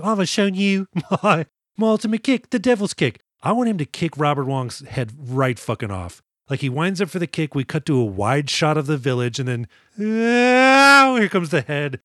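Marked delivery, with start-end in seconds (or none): 0.0-3.2 put-on higher, hammy British villain accent; a posh-sounding British baddie showing off his kick, voiced higher. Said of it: "'cause he's kinda British". 10.0-11.2 high, loud mock scream of a flying head.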